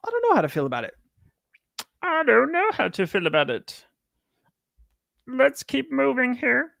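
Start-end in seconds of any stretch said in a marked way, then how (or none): 2.0-3.8 high puppet frog voice, lightly comic; sing-song Kermit impression. Shown as a demonstration. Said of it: "That was my Kermit the Frog voice". 5.3-6.7 high, slow frog puppet voice; drawn-out Kermit impression. Shown as a demonstration. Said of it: "That was my Kermit the Frog voice".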